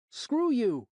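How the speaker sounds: high, loud bratty shout; kid yelling. A narrator's reading. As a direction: yell it like a punk.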